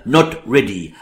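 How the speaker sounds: ordinary talk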